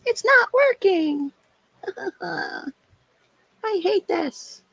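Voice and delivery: high, drawn-out bratty whine; mock tantrum in a high, pouty voice; whiny brat. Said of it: "I was just being a brat".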